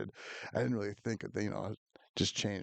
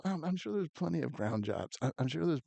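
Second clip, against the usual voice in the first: higher-pitched, defensive insistence; retold earnest protest, pitched up; defensive son; 7 semitones higher than usual. Son assuring his dad about ground jobs, defensive.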